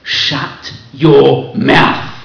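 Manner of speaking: loud, very slow, drawn-out order; loud public rebuke to a man talking in shul; mock loud rebuke. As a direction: show the wrong way to tell someone off. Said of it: "saying to him out loud".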